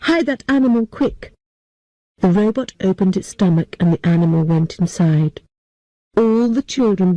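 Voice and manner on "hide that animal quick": a mild urgent call; shouting. Acted. Girl shouting to the robot to hide the animal. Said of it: "I shouted to the robot".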